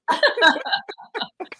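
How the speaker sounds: big high laugh; high burst of laughter. Real, not acted. Laughing at cupcake story.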